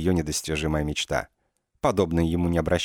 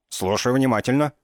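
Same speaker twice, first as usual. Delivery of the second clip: eager attention; 7 semitones higher, 4 dB louder than usual. Acted. Inspector snaps to attention.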